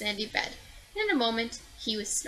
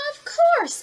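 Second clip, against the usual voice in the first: high, loud, bright yes; excited child calling back; eager answer; 10 dB louder than usual. Acted. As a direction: call it out eagerly, much higher. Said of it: "answered Rosalind".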